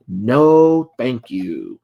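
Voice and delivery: hammed-up signature line; catchphrase delivery. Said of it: "here's her catchphrase".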